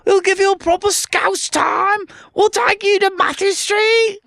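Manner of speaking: very high-pitched, badly faked Scouse; squeaky attempt at a Scouse accent. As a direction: mimic Liverpool locals in a hammed-up Scouse accent. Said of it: "that accent that you've just tried to do was not Scouse".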